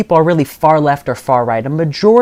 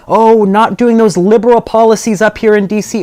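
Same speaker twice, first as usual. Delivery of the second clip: mocking quote, pitched higher; Garcia; 7 semitones higher, 4 dB louder than usual. Candidate quotes the congressman's noise, mockingly. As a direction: imitate a politician's stock complaint, higher-pitched.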